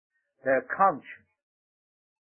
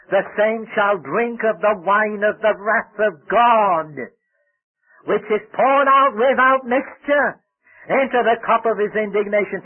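slow, loud, raised scripture proclamation; full-force preaching; 6 semitones higher, 6 dB louder than usual. Real, not acted. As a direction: thunder out the warning, drawing out each phrase.

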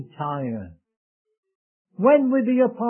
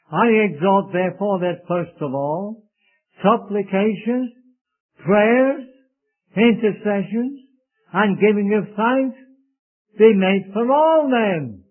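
louder, slowing to stress all; preacher reading Timothy with rising force; emphatic scripture reading; 3 semitones higher, 5 dB louder than usual. Acted. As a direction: proclaim the verse, building.

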